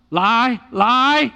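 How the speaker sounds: loud, rising, drawn-out LIE; emphatic preaching. Real, not acted. Repeats the contrast with force.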